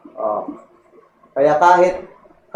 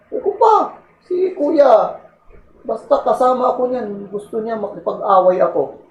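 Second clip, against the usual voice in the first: put-on whiny tattling child voice, pitched up; tattling kid; 7 semitones higher than usual. Little brother tattling to mom at home, whiny kid voice. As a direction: tell on your brother like a kid.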